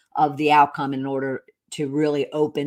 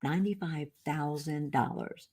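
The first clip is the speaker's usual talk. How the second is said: much softer, letting the figure sink in; hushed disbelief; 11 dB quieter than usual. Real, not acted. Telling listeners of a shocking tax debt, hushed. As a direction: repeat it quietly, as if stunned.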